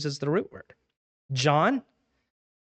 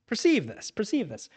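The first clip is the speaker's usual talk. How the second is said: raised pitch, hammed-up formality; mock formal, pitched up; mock stuffy command; 6 semitones higher than usual. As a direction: play a pompous speaker showing off the word. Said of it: "that was the way I talked".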